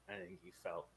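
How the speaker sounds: very quiet, tentative reply; hushed, mock-sheepish admission; meek answer. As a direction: answer softly, as if in trouble. Sheepish answer at captain's hearing.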